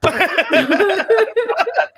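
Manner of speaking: high, slow, laughing start. Real, not acted. Host laughing at guest's typo story, high and slow. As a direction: laugh your way into the words.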